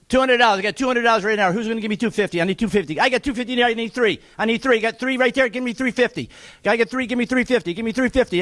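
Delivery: rapid, rhythmic auctioneer patter pushing the bids; auctioneer bid calling. Acted. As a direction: keep the numbers rolling like a real auctioneer. Charity auction bidding call.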